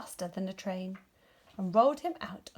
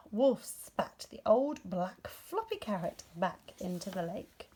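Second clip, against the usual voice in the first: plain reading voice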